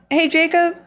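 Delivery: high sing-song hey; playful call. Acted.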